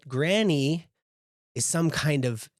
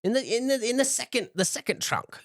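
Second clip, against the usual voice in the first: put-on a much higher old granny, fondly scolding; 10 semitones higher, faster than usual. Granny scolding her grandson Jinx, high and fond. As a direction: play the fussing giant grandma, pitched way up. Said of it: "She's like".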